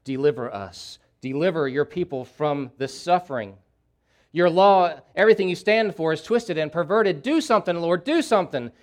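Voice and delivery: building from plea to a loud, high cry. Acted. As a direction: start asking, end shouting do something. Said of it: "crying out to God, saying".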